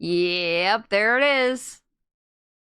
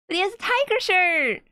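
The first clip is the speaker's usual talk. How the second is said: delighted; 8 semitones higher than usual. Real, not acted. Delighted reaction to character design.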